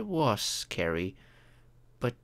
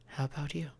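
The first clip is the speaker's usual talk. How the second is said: ordinary talk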